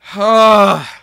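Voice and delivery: huge, loud, high sigh; exasperated sigh. Real, not acted. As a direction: heave out a loud sigh of frustration. Exasperated by stream failing.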